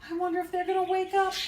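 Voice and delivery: usual speaking voice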